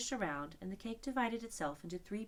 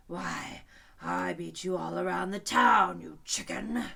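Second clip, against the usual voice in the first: louder scornful lion; angry lion; 9 dB louder, slower than usual. A narrator's reading. Lion angrily taunting the unicorn, louder. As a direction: taunt him hotly. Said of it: "the lion replied angrily".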